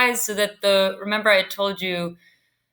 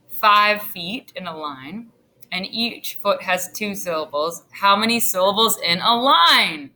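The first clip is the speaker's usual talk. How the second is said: ordinary talk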